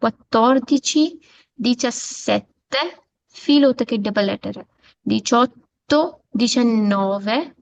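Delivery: "Quattordici" and "diciassette" drawn out, both letters of each double consonant sounded; teacher stressing the double letters. Shown as a demonstration. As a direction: lean on the doubled consonants, slowly. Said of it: "दोनों को ही किया जाता है".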